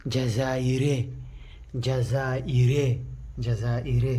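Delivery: slow, drawn-out جزائري with a falling end; slow drilled word. Shown as a demonstration. Teacher drilling the class on the word, slow and clear. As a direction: say جزائري slowly for the class to copy. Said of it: "واحد رجل من جزائر نقول".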